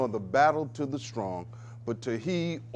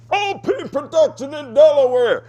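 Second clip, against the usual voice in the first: loud booming announcer, pitched way up; radio announcer voice; 12 semitones higher, 12 dB louder than usual. Retold or imitated. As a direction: belt out an imitation of a radio ident. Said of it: "you hear that deep voice".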